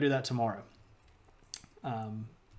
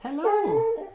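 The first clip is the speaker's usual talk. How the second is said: very high-pitched pet voice; puppy talk; 3 dB louder, slower than usual. Real, not acted. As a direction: squeal a greeting to your puppy. Talking to the puppy.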